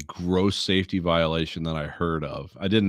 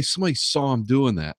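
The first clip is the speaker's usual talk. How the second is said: ordinary talk, nothing marked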